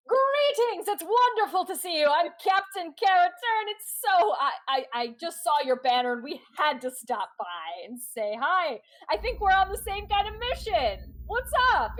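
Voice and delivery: high, loud, gushing greeting; effusive rival captain. Acted. Rival captain hailing a crew she has just boarded, effusive. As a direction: greet them with booming, high-pitched cheer. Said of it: "person in the lead says".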